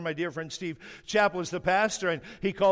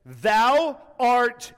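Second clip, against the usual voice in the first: loud, forceful THOU ART; emphatic declaration; 3 semitones higher, 6 dB louder than usual. Shown as a demonstration. Preacher showing how Peter said it, emphatic. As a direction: punch out each word boldly. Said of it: "here's how he said it".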